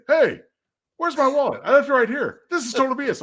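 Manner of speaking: high, loud blustery Bulldog rant; gruff Bulldog voice. Retold or imitated. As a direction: play Bulldog fuming over a lost wallet. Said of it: "Bulldog would say".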